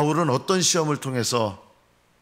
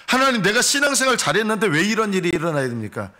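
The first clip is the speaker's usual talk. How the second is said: higher, louder indignant protest; complaining to God; 7 semitones higher, 4 dB louder than usual. Retold or imitated. Preacher acting out a believer protesting his trial, indignant. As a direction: demand an answer from God, offended. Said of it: "이런 말 하면 안 되는 거예요".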